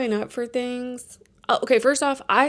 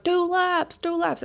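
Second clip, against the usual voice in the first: mock drill-sergeant call, pitched much higher; bossy coach; 9 semitones higher, slower than usual. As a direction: bark it like a gym coach running a boot camp. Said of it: "and he was like".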